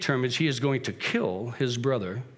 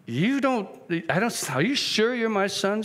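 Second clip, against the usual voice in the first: higher, halting voice of an unsure father; doubting father; 7 semitones higher than usual. Acted. Father feeling his son's arm, acted doubt. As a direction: start and restart, pitched up in doubt. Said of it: "and he goes".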